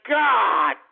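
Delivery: exasperated outburst. Real, not acted. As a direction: burst out in sheer exasperation. Host exasperated by domestic row clip.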